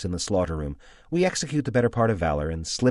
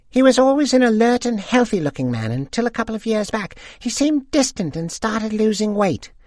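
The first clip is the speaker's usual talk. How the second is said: put-on much higher gossiping old woman voice; old lady; 4 dB louder than usual. A narrator's reading. Second old lady gossiping with friends, high voice. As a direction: chime in like a gossipy widow.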